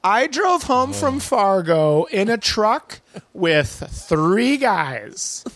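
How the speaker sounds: put-on high, slow airhead girl voice; high-pitched mocking gushing girl; valley girl. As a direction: gush like a giddy girl. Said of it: "girls will be like".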